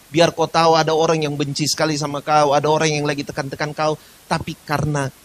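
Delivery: forceful preaching. Acted. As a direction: preach it loud and low, building.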